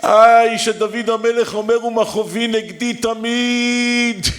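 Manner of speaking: high, loud, slow preacher's cry; impassioned preaching. Acted. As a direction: preach at full force, pleading. Preaching at full force.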